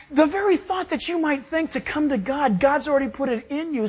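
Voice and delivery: normal talking voice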